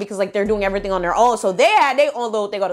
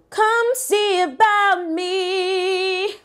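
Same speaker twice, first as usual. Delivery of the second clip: drawn-out, higher taunt; taunting challenge; 8 semitones higher, much slower than usual. Real, not acted. Daring the group chat girl to come, slow higher taunt. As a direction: dare them, slowly.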